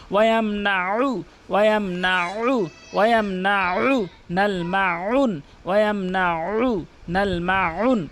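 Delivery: slow "ওয়ায়ামনাউ", squeezing the throat before the final u; ayn from mid-throat. Shown as a demonstration. Teacher models ayn, slow throaty recitation. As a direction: stretch "মাউন" around a pharyngeal ayn. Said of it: "আইনের উচ্চারণ করতে হবে হলকের মাঝখান".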